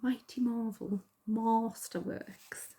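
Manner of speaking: slow, drawn-out mock Geordie; exaggerated Geordie, savouring each word; Geordie accent. Shown as a demonstration. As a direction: play up the Geordie vowels, very slowly. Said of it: "I sound so Geordie there".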